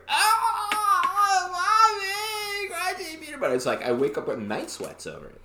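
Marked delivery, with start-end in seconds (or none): 0.1-3.5 put-on high-pitched whiny kid sobbing; crying child voice. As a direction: wail like a little kid in tears. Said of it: "I was crying".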